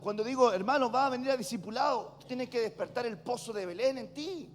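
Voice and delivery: forceful, driving sermon delivery; preaching. Real, not acted. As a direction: preach it hard, building to a loud challenge. (predicando con fuerza)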